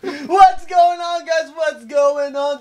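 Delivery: big shouted hello; loud greeting. Real, not acted. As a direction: call out the opening. Podcast opening call-out.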